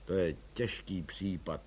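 low, soft sighed words; sighing. A narrator's reading. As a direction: sigh it out wearily.

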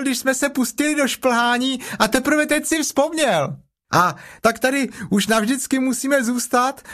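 put-on very high, childlike chatter; high-pitched young kangaroo; excited little Roo. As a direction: gush like a thrilled little one.

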